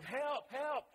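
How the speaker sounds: high, loud cry of help; retold yell for help. Woman trapped in the subway cries for help, loud mock yell. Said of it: "she's yelling".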